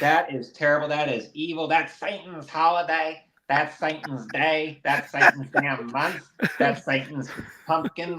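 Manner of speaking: hammed-up Southern preacher outrage; slow Southern twang, mock horror; Southern accent. Mocking Christians who condemn haunted houses, in a Southern accent. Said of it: "you got the accent down".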